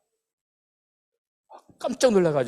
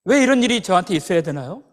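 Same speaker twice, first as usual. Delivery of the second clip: raised, pleading demand to God; protesting prayer; 4 semitones higher, 6 dB louder than usual. Acted. Believer in pain asking God why, anguished protest. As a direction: plead upward, growing louder with each why. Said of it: "기도하는 것입니다".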